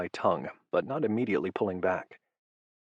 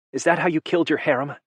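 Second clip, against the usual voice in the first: high, loud and fast, hurling the accusation; loud accusing demand; furious demand; 8 semitones higher, 4 dB louder, faster than usual. A narrator's reading. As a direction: demand an answer, voice up and pushing. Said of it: "she demanded".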